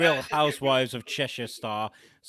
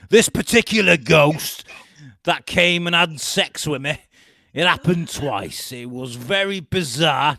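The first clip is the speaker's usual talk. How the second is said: loud, hammed-up Liverpudlian twang; Cheshire accent; 2 semitones higher, 9 dB louder than usual. Quoting reality star about her ghost, loud Cheshire accent. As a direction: declaim it in a put-on Cheshire accent. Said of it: "I was gonna say a bit Liverpudlian".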